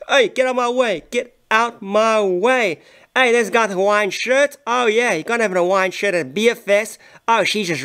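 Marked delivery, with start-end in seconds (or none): 1.1-2.8 very slow, drawn-out get out my way; lazy drawn-out order; slow demand. Acted. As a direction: stretch every word of get out my way. Voicing pushy dancer.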